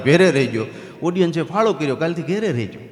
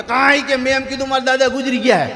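high mock shout from a hidden boy; shouting from hiding; 9 semitones higher, 3 dB louder than usual. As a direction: yell it across the street, cheeky. (સંતાયેલા છોકરાની ઊંચી બૂમ)

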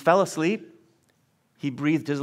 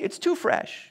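higher, soothing tempter voice with too leaned on; tempting enemy; 9 semitones higher, slower than usual. Acted. The enemy urging a hurt believer to wait, high coaxing voice. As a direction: coax them that "too fresh" means wait. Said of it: "could be whispering to you".

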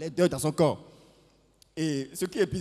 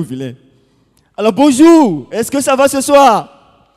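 loud, high, drawn-out hello to the crowd; big stage greeting; 8 semitones higher, 16 dB louder, slower than usual. Acted. Comedian greeting the audience at the start, loud and high. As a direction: call out to the whole room, slow and bright.

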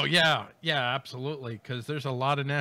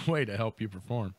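usual speaking voice

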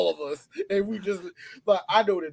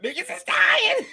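squealing mock-alarm shout, slowed; slower than usual. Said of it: "She was like".

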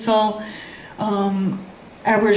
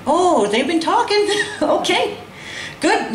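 laughing realisation; 8 semitones higher, slower than usual. Retold or imitated. Inner thought, sudden realisation, laughing.

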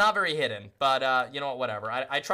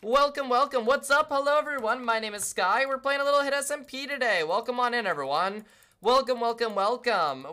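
higher, louder streamer greeting; hype raid welcome; 8 semitones higher, 4 dB louder than usual. Acted. Streamer greets raiders joining chat, bright hyped welcome.

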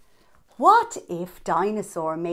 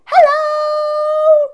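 much higher, louder held hello; party shout; 12 dB louder than usual. Acted. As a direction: shout it like a surprise crowd. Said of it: "everyone shouted".

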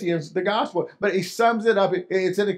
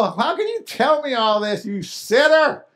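mocking scoff; 6 semitones higher, 3 dB louder than usual. Loved one scoffs at believer.

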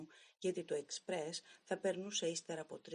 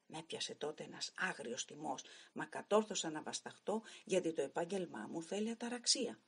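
plain reading voice

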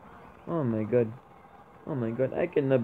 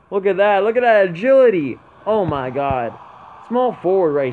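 loud, high, amazed; excited shout; 7 semitones higher, 11 dB louder than usual. Real, not acted. Gamer showing viewers the agility, loud and thrilled. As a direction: show off the move like a hype man. Marvels at player move.

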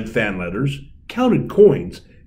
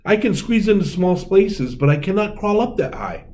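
mouse voice; 8 semitones higher than usual. A narrator's reading. Mouse lamenting.

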